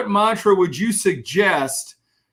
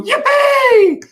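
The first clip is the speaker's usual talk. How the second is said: high, loud wake-up cheer; a joyful morning mantra yelled out; excited morning shout; 12 semitones higher, 7 dB louder, slower than usual. Retold or imitated. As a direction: leap out of bed yelling with joy. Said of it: "pop up on the side of his bed and go".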